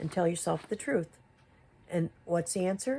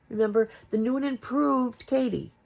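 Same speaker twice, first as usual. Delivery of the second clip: sarcastic mock; 6 semitones higher, 4 dB louder than usual. Sarcastic jab at Katie.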